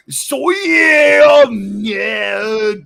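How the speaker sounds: put-on shouted Yorkshire accent, high and loud. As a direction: yell it in broad Yorkshire. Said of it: "get my Yorkshire accent on".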